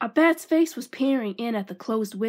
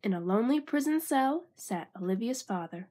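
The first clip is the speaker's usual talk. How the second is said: ordinary delivery, nothing marked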